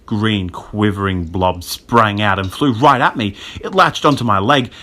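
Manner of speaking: usual speaking voice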